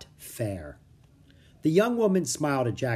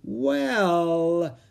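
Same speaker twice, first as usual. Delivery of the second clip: slowly; 6 semitones higher, 4 dB louder than usual. A narrator's reading. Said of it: "said Plato slowly".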